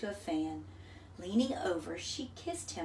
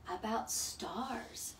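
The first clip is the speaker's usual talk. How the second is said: soft slow mother; 7 dB quieter, much slower than usual. A narrator's reading. Mother answers softly.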